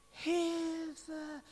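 mock timid call, barely audible; half-hearted scream. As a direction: feebly fake a cry for help. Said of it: "Nee, das ist nicht schreien".

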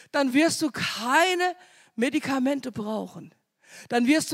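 high, loud fervent preaching, building to a cry; preaching at full force. Acted. Preacher crying out to the congregation that the Lord heals, fervent and loud. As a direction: proclaim it to the whole congregation at full voice. Preacher proclaims God's covenant.

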